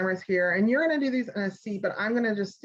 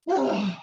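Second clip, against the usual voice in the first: dramatic sigh. Shown as a demonstration. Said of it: "let's be very dramatic about it".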